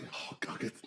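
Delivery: whispered aside. Real, not acted. Aside to someone else.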